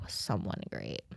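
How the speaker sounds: soft low mutter of Someone Great. Shown as a demonstration. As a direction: mumble the title under your breath. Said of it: "Someone Great on Netflix".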